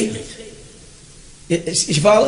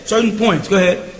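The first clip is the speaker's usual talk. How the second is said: ordinary talk, nothing marked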